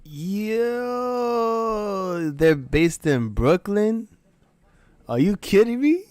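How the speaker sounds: high, loud, incredulous yelling; can't believe it's Brooklyn; shocked disbelief. Real, not acted.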